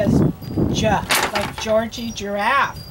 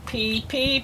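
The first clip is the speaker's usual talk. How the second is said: ordinary talk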